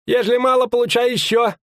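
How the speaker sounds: forced, very high innkeeper's cry; strained high-pitched show of heartiness; unnatural exclamation. Acted. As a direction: exclaim it too high, for others to overhear. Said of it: "неестественно воскликнул корчмарь".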